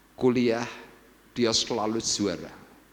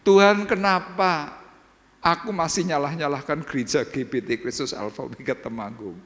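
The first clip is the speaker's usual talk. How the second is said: model complaint prayer; 5 semitones higher than usual. Shown as a demonstration. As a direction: show them how to complain to the Lord. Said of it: "Coba Anda ngeluh itu aja dulu". (memperagakan keluhan kepada Tuhan dengan nada tinggi)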